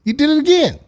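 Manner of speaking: high, loud startled exclaim; a sudden loud surprised squeal; surprised outburst. Real, not acted. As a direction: jump up in disbelief. Caller took another screenshot.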